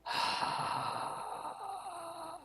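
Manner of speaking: long, soft sigh of reluctance; pained sigh. Real, not acted. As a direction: heave a long, quiet sigh. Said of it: "You sound like that hurt so bad".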